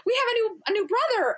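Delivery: put-on gasp, then high and loud child's thrill; four-year-old son thrilled at the new baby, excited; excited little boy. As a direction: squeal with wonder at a new brother. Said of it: "so excited".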